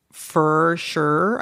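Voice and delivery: "for sure" said the southeastern Michigan way, "for" drawn toward "fur". Shown as a demonstration. Host recalling her Michigan habit, saying "for sure" with a Midwest vowel. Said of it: "I used to say f".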